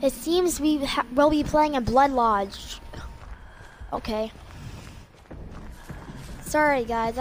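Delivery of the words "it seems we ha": usual speaking voice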